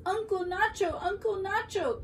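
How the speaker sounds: put-on high-pitched child's call; calling at the door. A narrator's reading. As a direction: call out to your uncle, high and singing. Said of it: "came a voice at the door".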